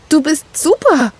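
high-pitched delighted cry; overjoyed. A narrator's reading. Gerda praising the neighbour who helped, overjoyed. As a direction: call it out high, beside yourself with joy. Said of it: "rief Gerda außer sich".

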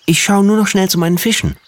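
put-on high child voice calling back; a little boy calling out as he runs off. A narrator's reading. Boy calling to his parents before leaving, high. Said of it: "rief Stefan".

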